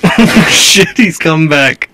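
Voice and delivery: loud, startled reaction; alarmed outburst. Real, not acted. Viewer reacts as he's coming back, alarmed. Reacts to clip character returning.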